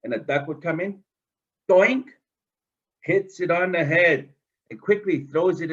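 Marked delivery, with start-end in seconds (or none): 1.7-2.1 doink bonk noise. Acted. Sound of hitting duck.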